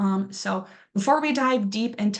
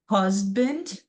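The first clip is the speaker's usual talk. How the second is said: husband with the HUS strong and the ending weak; stress on first syllable. Shown as a demonstration. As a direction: repeat husband leaning on HUS. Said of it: "a little bit more stronger when we're starting".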